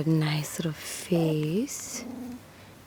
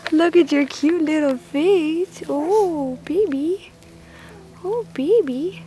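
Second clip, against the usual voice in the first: overwhelmed by cuteness; 10 semitones higher, 3 dB louder than usual. Real, not acted. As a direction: squeal it, gushing over the puppy. Squealing at the puppy.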